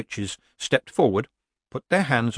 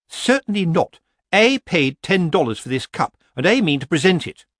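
indignant, pitched-up refusal; haughty Missus Earwig; 8 semitones higher, 4 dB louder than usual. Acted. Missus Earwig insists on presenting her cup, haughty and indignant. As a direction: refuse in an affected, higher voice.

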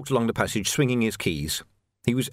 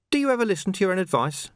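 a touch higher, quick and cheeky; lightly voiced watchman; 9 semitones higher, faster than usual. Young watchman taunting Vimes at bowpoint, quick and cheeky. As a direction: needle him with a quick jab.

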